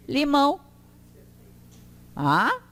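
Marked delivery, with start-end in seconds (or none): none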